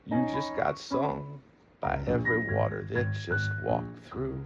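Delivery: slow, lofty spoken-word delivery; spoken word poem. Acted. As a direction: recite it like a poem. Poetic spoken-word over music.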